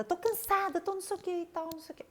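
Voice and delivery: high, whiny imitation of her old irritated self; mock irritated whine. Mother imitating her old irritated complaints, high mock whine. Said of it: "eu ficava mais irritada".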